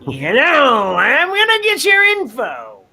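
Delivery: high, drawn-out teasing call; mock eavesdropper. Co-host plays someone listening in on phones, mock eavesdropper. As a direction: put on a high, silly snooping voice.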